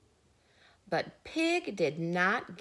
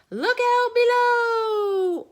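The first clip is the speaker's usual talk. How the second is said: Pig's yell; 12 dB louder, slower than usual. Acted. Pig cannonballs into the pond.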